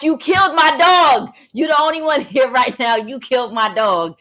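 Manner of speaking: high, loud yell easing off; shouted accusation. Retold or imitated. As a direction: re-enact an outraged accusation. Retold repeated accusation.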